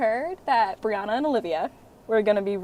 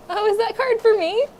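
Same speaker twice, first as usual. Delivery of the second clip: hopeful excitement; 12 semitones higher, 5 dB louder than usual. Acted. Hoping the card is hers.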